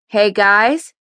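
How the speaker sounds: calling out lightly; a restrained call to the others; mild yell. A narrator's reading. Mother calls the boys to dinner, mild yell. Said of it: "she yelled".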